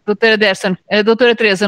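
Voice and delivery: loud call to get her attention; calling out loudly. Real, not acted. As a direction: raise your voice to call her. Calling a colleague back in.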